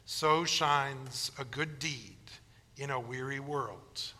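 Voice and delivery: soft, slow, hushed line; tender. Retold or imitated. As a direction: let it land gently. Wonka's tender closing line.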